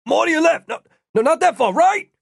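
high, urgent shouted directions; rapid-fire calls, rising to a shout; yelling. A narrator's reading. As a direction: yell corrections to someone running.

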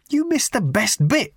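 high, indignant rage; raging. A narrator's reading. Ford raging that Arthur missed the fight, high. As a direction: fling the complaint up high. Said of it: "raged Ford".

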